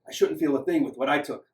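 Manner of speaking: plain reading voice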